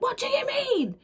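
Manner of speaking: high mock outrage; shrieky pitch without extra volume; livid mum imitation. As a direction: imitate an incensed mum squealing. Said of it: "Anyway, she was livid".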